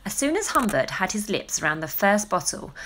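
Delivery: usual speaking voice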